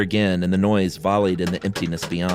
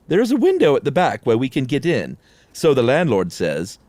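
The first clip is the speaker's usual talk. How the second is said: higher, louder eager voice; Lester's voice; 5 semitones higher, 4 dB louder than usual. Acted. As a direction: pipe it up like nervous Lester. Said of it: "said Lester".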